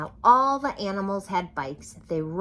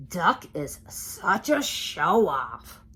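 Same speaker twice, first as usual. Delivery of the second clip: sneering pig voice; 4 dB louder, slower than usual. A narrator's reading. Pigs' scornful thought.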